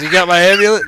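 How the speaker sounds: loud, high-pitched mimicry; mock-oblivious character voice; mocking imitation. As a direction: parrot the question in a high, loud voice. Mocks the amulet question mid-battle.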